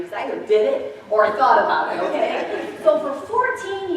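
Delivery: loud laughing confession. Real, not acted. Preacher laughing loudly while confessing her wild past. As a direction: laugh through it.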